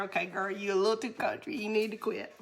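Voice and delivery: soft, quick aside imitating husband; slipped-in correction. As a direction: gently slip in a chiding correction. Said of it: "try to slip in there".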